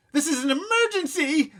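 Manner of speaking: high, loud wailing cry. A narrator's reading. Mother wailing to the elevator operator. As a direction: wail at the elevator man. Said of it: "Mom wailed".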